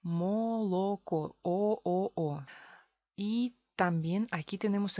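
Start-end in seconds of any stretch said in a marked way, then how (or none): 0.0-2.4 molocó very slowly, every o long and round; molocó as spelt, three full o vowels; official unreduced reading. Shown as a demonstration. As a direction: stretch molocó into mo-lo-ko, then each O alone. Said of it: "sin reducción, oficialmente".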